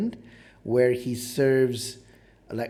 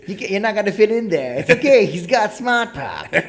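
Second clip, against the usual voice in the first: higher, louder and fast, hammed-up cheerful ad read; mock car advert voice; 7 semitones higher, 7 dB louder than usual. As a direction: sell it like a cheesy car commercial. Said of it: "It says".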